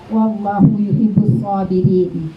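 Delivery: slow, measured Arabic recitation; Quran recitation. Acted. Ustazah quoting the verse to teachers, in a slow, reverent way.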